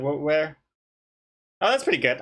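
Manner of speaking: a light, nervous stumble on "wh-" before "where?"; mildly nervous, catch on the first sound then ask; nervous stutter. Shown as a demonstration. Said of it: "kinda stuttering a little bit".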